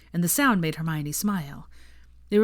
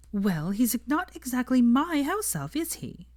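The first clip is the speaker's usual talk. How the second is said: prim, slow and higher; primly; 7 semitones higher, slower than usual. A narrator's reading. Hermione correcting Ron about the elf, primly. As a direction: set it straight, prim and proper. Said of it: "said Hermione primly".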